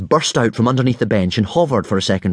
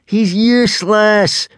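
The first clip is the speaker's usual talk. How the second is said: high, loud, drawn-out jeer; gleeful crowing; 12 semitones higher, 5 dB louder, slower than usual. A narrator's reading. Rival gloats over Hiccup to the boys, crowing gleefully. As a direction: crow it slowly and triumphantly. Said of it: "crowed Snotlout gleefully".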